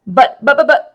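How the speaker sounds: stammered high and loud; sputtering. A narrator's reading. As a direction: sputter the buts in a panic. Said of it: "I sputtered".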